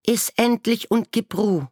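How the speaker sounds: high, loud, scolding snap; annoyed mother. A narrator's reading. Sparrow mother snapping at her chick, high and loud. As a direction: shut the child up, irritated. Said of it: "antwortete die Spatzenmutter verärgert".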